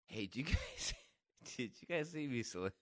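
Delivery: retold quote broken by real laughter; laughing while telling. Real, not acted. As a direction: barely get the question out for laughing. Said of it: "I can't tell this story without laughing".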